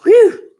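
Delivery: loud, high relief; relieved whew. A narrator's reading. Cal sighing after escaping. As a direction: blow out relief. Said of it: "Cal sighed".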